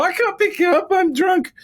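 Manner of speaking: put-on very high, loud drunken squeal. Drunk reply to friend.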